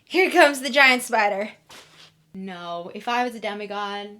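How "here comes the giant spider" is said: loud dread at spider; dreading shout. Real, not acted. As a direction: cry out in dread.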